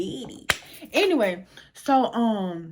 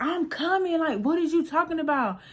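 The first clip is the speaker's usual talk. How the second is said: higher-pitched, put-upon comeback; mock defensive retort; 8 semitones higher than usual. [name] answering Oscar's hurrying, mock defensive. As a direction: push back in a raised pitch.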